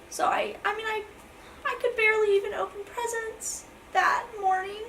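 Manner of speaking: crying through the words, really upset; tearful. Real, not acted. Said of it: "I'm trying not to cry".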